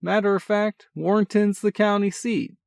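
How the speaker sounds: put-on high, loud, brash older brother voice; a cocky big brother, full of energy. A narrator's reading. Older brother teasing on the phone, brash and cheerful. Sean voice again.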